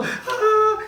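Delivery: high-pitched "aah" at ordinary volume; imitated child's scream. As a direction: mimic a shocked child's shriek. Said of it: "Y grita".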